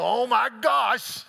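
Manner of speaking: high, loud, drawn-out mock alarm; mock shocked gasp. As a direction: send up a horrified interviewer. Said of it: "they go".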